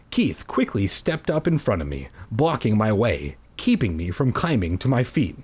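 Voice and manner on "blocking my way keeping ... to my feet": ordinary talk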